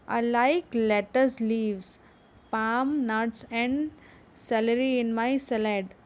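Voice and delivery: slow, careful reading for the class; deliberate teacher read-aloud. Shown as a demonstration. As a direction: read it slowly so they can follow. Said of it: "उसको ज़ोर से पढ़ेंगे".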